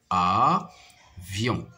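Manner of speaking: "Avion" with a long gap after a; syllable by syllable. Shown as a demonstration. Teacher counting two syllables for pupils, syllable by syllable. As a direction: separate "Avion" into a and vion. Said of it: "se compose de deux syllabes".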